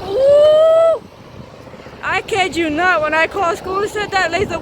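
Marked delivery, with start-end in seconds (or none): 0.1-1.0 very high, loud panicked ooh. Retold or imitated. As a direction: squeal it out in panic. Panic while calling school.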